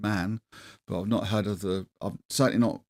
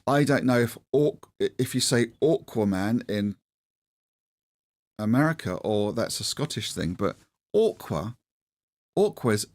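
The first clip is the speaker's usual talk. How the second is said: ordinary delivery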